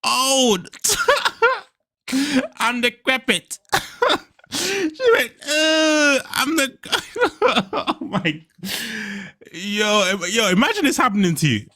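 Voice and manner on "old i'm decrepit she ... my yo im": high, slow wheeze through laughter; barely gets it out between laughs; howling at the clip; crying laughing. Real, not acted. Still cracking up.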